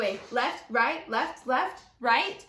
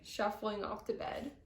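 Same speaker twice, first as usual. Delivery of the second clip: plain reading voice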